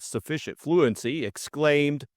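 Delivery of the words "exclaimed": plain reading voice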